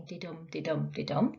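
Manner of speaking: "didum" soft first beat, stress on the second "dum"; da-DUM rhythm, light and even; iambic didum. Shown as a demonstration. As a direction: tap out "didum" with the weight on "dum". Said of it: "The stress falls decidedly but not heavily on the second".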